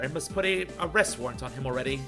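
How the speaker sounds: normal talking voice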